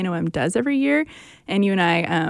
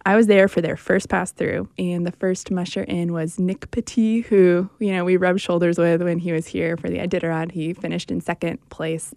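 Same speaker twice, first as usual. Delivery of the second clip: normal talking voice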